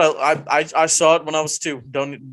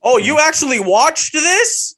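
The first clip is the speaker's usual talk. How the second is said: teasing surprise; 11 semitones higher, 5 dB louder, slower than usual. Real, not acted.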